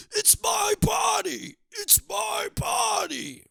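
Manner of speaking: high, slow shrieking yell of "it's my body"; mock scream. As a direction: screech it like a hammed-up tantrum. Mock outrage at club bump.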